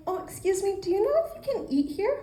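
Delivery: high, fast, sugary sweet voice; playing the damsel to ask him, in a high sweet voice; sweetest voice. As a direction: put on your sweetest damsel-in-distress voice. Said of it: "in the sweetest voice I could muster up".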